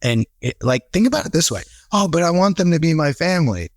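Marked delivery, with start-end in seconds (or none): 1.9-3.7 higher, mocking plea; mock whiny team leader. Coach mocking leaders who want staff as family, whiny.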